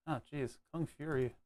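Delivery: ordinary talk, nothing marked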